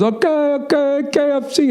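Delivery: high, mock driver voice; driver's voice. Car service driver insisting on phone, high mock voice. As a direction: squeak it like the clueless driver.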